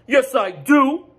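high, loud and slow, defensive; cried out. Acted. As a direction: shout it back, dragging it out. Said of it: "Goose cried out".

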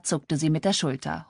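usual speaking voice

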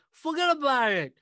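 put-on loud, high New York City accent, forget about it; brash New York accent, thrown out big and high. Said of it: "the best New York City accent I can do".

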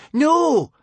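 piercing high, loud shout. A narrator's reading. Wish on the floor shouting back at the witch, piercing shout. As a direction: scream it with everything she has. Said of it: "she too shouted".

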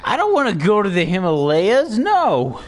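high-pitched refusal climbing with each no; whiny protest. Real, not acted. Player refusing to go to the Himalayas, whiny high protest.

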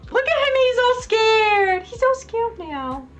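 high sing-song taunt; mocking. Real, not acted. Gamer taunting the defeated boss, high mocking gloat. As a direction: gloat over the beaten enemy.

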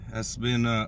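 ordinary talk, nothing marked

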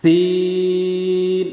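সিন stretched very slowly, the vowel held long; long drawn sin. Shown as a demonstration. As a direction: draw out সিন for three alif counts. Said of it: "তিন আলিফ পরিমাণ টান হবে". (সিন টেনে তিন আলিফ পরিমাণ লম্বা করে পড়া)

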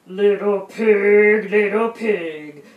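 loud, drawn-out wolf call; booming wolf voice, stretching every word; big bad wolf. A narrator's reading. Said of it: "cried the big bad wolf".

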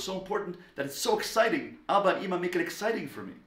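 lightly voiced eager child; excited child. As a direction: gush it out fast like a thrilled kid.

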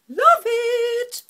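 high, loud squeal of delight. Real, not acted. Seeing her finished eyeshadow, a high delighted squeal. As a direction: shriek with joy at the colours. Thrilled by colour result.

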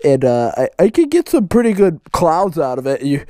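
vape bro. Acted. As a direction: ramble about cars and coils like a stoned vaper bro. Plays a vape bro character.

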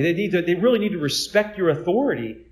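high, loud, whiny mock customer; mock entitled diner. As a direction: ham up a spoiled diner demanding a refill.

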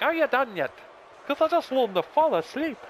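very high, loud mock Irish; hammed-up, high-pitched Irish taunt. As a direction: squeal it in a put-on Irish accent. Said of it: "do a little Irish voice".

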